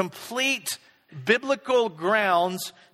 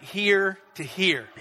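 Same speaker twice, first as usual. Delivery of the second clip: ordinary talk, nothing marked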